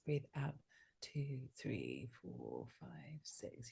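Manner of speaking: soft, slow breath count, fading to a whisper. Shown as a demonstration. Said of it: "Let's go. I'm gonna count your breath".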